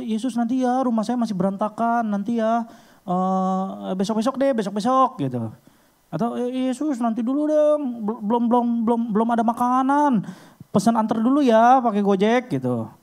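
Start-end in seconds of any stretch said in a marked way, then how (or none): 0.0-5.3 high-pitched, put-off pleading; stalling excuse-maker. Acted. Zacchaeus acted stalling Jesus. 6.4-12.5 high, stammering stall; flustered excuse-maker. Acted. Zacchaeus acted stammering excuses.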